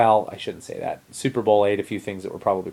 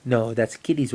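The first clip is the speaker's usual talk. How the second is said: normal talking voice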